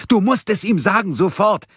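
viel höher und lauter gerufen; fast brüllend. A narrator's reading. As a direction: ins Telefon brüllen. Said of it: "brüllte ihr jetzt fast ins Ohr". (Gabriel brüllt Mia fast ins Ohr)

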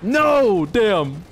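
high "no" dropping to resignation; real gamer dismay; frustrated outcry. Real, not acted. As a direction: cry out, then give in. Loses fight in game.

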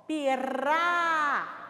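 "pirá" very slow, the r tapped with the tongue; trilled r. Shown as a demonstration. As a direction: drag out "pirá" and tap the r with the tip of the tongue. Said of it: "eu tremo a língua". ("pirá" bem devagar, tremendo a língua no r)

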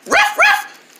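high, breathy little puppy yap; puppy barking. Acted. The pet puppy Tongues greeting the viewers with a yap. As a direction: yap like a tiny excited puppy. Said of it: "this is our pet puppy dog".

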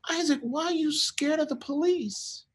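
very high, soft, tender question; soft high voice, careful with a child; gentle concern. Retold or imitated. Dad asking his young son why he fears police, gently.

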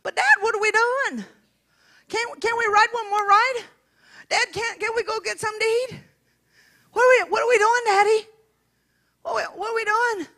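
put-on high, insistent child voice; pleading little girl. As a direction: pester Dad with anxious questions. Acts little girl questioning Dad.

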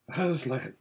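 understated shaken address. A narrator's reading. Humbled horse answers the lion, shaken voice. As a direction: say the name mildly shaken. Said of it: "in a shaken voice".

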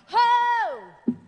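high, loud, sudden "ho"; startling shout. Shown as a demonstration. Preacher re-enacting his shout in worship, startlingly loud. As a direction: let out a booming worship shout. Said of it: "I know I scared you".

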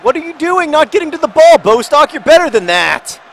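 high, loud scolding of a player; real frustrated outburst at full volume; angry, frustrated shouting. Real, not acted. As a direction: yell at your own player in exasperation. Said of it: "Sorry, I'm getting angry now. I'm getting frustrated".

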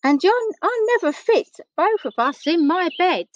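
high, plaintive little voice; complaining Eddie. A narrator's reading. Storyteller voices Eddie complaining to the giant teddy, plaintive.

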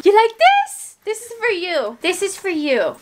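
squeaky voice offering the dog a toy; 1 pet talk. Real, not acted. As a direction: coo a question at the pet. Talking to her dog.

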